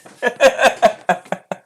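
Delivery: high, loud laugh; laughter. Real, not acted. Streamer reacting to Kaiser's draw, loud laughter.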